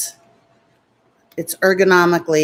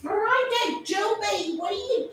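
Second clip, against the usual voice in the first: pet talk; 12 semitones higher, 9 dB quieter than usual. Real, not acted. Talking to her pets Miranda and Joe, high soft baby voice. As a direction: coo at the pets.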